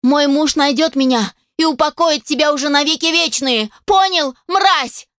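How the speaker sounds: high, defiant outburst; furious threat. A narrator's reading. Captive wife threatening creature with husband's revenge, furious. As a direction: build to a high, furious finish.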